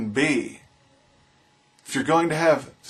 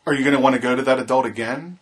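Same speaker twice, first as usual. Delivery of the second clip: usual speaking voice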